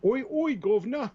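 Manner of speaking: high, loud cockney-style English greeting; mock English accent. Host greets co-host as an Englishman, loud mock English accent. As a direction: hail them in a hammed-up English voice.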